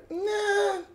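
high, soft, whiny nah; mock-dismissive whine; acted dismissal. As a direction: wave it off in a small high voice. Voices player dismissing the threat.

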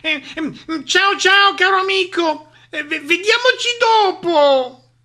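high, loud stammered goodbye; hesitate, then blurt a shrieky farewell; flustered escape. Acted. Owl flees the monster, flustered and squealing.

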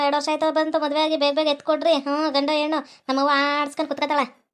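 ordinary talk, nothing marked

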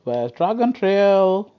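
slow, stretched-out search question; slow drawn-out question. Real, not acted. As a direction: drag each word out while hunting for the track. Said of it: "repeats the search question, slowed".

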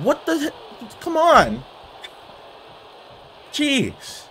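very high, disbelieving whine; high-pitched frustrated protest; exasperated squeal. Real, not acted. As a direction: voice jumps up into a squeak. Interrupted by setback in game.

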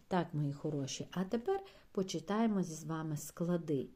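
usual speaking voice

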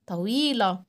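طويلة with a stretched middle; long ii in طويلة. Shown as a demonstration. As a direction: draw out the ii of طويلة. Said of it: "هنطول صوتنا قوي".

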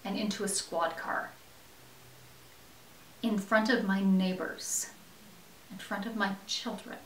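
softly, trailing down into shame; pained confession. Acted. Inmate recalls arrest before neighbors and children, pained and hushed.

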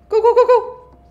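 high, loud rapid go go go; frantic high shout; frantic urging. Real, not acted. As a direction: panic to make yourself run. Fleeing enemy in horror game.

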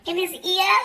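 ordinary talk, nothing marked

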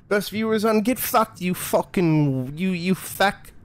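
high, hammed-up bad British accent; mock British accent. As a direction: sputter insults in a fake British accent. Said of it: "bastardization of their accent".

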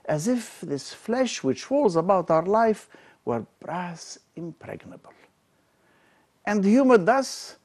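declaimed Shakespeare, rising and falling with feeling; dramatic verse reading. Acted. Lecturer to students, reading King Richard's lament with dramatic weight. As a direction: give the speech its full tragic weight. Reads Richard's speech aloud.

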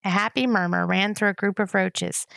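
usual speaking voice

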